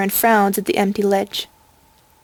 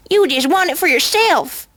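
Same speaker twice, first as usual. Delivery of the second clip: accusing; 7 semitones higher than usual. A narrator's reading. Malicious accusation over the ring.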